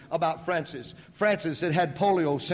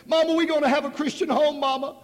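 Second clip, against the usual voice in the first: much higher and louder, crying out with joy; ecstatic cry; 10 semitones higher, 6 dB louder than usual. Retold or imitated. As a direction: let the joy burst out. Repeated, building joy.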